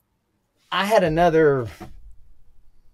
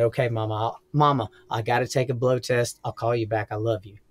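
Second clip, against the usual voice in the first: tender goodbye; 7 semitones lower, 5 dB quieter than usual. Retold or imitated. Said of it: "I said".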